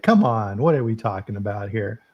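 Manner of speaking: normal talking voice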